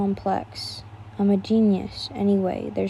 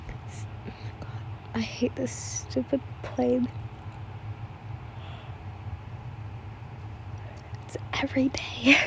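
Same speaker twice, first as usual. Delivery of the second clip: annoyed whisper; 12 dB quieter than usual. Real, not acted. Annoyed at plane noise.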